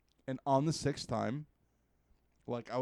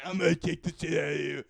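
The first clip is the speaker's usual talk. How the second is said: louder, menacing boss voice; boss threat; 8 dB louder, faster than usual. Retold or imitated. Gang boss threatens the hero, loud brute voice.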